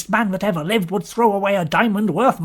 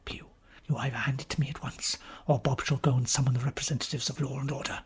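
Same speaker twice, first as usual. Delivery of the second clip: low, soft and fast; quiet threat; 6 semitones lower, 10 dB quieter, faster than usual. Acted. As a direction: hush it out as a cool warning. Tress threatening Pugh to return diamond.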